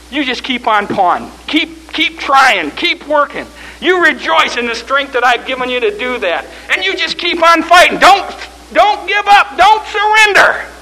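preaching that builds to a high, loud cry; mounting sermon climax; rousing exhortation. Acted. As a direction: urge them on, climbing toward full force. Preaching builds as God exhorts Job.

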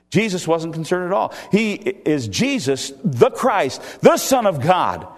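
building to high, loud preaching; impassioned preaching. Real, not acted. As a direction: proclaim it with mounting force. Preaches with rising force.

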